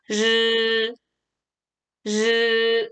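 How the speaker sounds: 르 held level as a buzzy curled r; retroflex ri. Shown as a demonstration. Teacher models the sound, long retroflex 르. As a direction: curl the tongue back and sustain 르. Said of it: "이렇게요".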